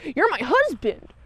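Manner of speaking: put-on high, insistent old wife voice; imitating a pleading wife. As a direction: plead up high with her lost husband. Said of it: "she's like".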